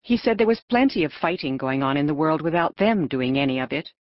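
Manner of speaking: usual speaking voice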